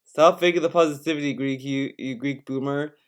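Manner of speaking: usual speaking voice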